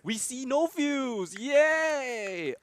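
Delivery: high, stretched-out celebratory call; drawn-out cheer. Real, not acted. Caster gloats to co-caster over no Fuse, drawn-out cheer.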